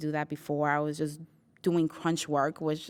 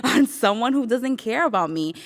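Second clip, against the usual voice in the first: higher, louder, laughing it out; laughing defiance; 6 semitones higher, 7 dB louder than usual. Retold or imitated. Quitting her job, laughing defiantly. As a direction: laugh through the vow to quit.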